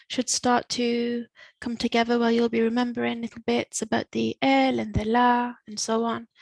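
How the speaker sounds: normal talking voice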